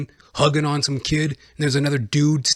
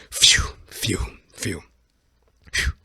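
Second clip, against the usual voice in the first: whispered, airy repeated phews; exhaled relief; 7 semitones lower, much slower than usual. Acted. Comedian learns it was a false alarm, exhaling with relief. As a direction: blow out breath in acted relief.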